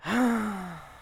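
long, weary sigh; exasperated sigh. Real, not acted. Co-host hears the muddled case summary, exasperated sigh. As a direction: let out a big sigh of exasperation.

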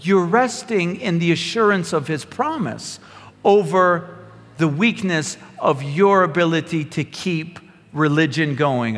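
usual speaking voice

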